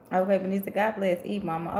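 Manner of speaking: ordinary delivery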